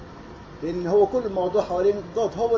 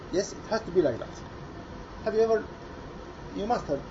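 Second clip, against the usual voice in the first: ordinary talk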